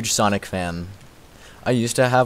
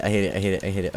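ordinary talk

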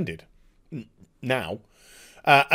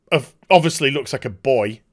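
usual speaking voice

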